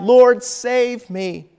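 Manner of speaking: high, loud, slow desperate plea; crying out. A narrator's reading. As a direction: cry for rescue as the waves close in. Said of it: "he cried out".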